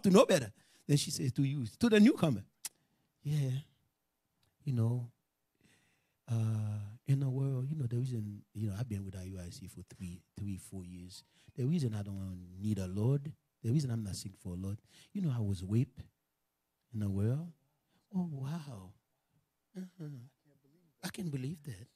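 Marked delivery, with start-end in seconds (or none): 3.2-17.5 low, soft, halting confession; hurt confiding. Retold or imitated. Preacher plays a sister confiding old hurt, subdued and halting. 18.1-21.9 soft, low murmured disbelief; hushed sympathy. Retold or imitated. Preacher plays a listener hearing painful news, hushed sympathy.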